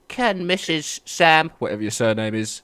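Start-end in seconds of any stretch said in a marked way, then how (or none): 0.1-1.5 high, slow tannoy announcement; shop announcer. Retold or imitated. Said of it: "the Tesco's announcer over the tannoy".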